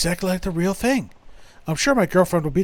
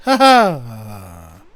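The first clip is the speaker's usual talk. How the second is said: loud mock laugh; 7 semitones lower, 7 dB louder than usual. Majima laughs at the couple.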